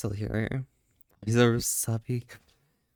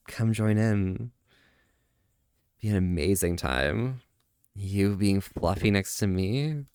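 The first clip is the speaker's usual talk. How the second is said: flirty teasing; 7 semitones lower than usual. Acted. Flirty cuddle talk, low.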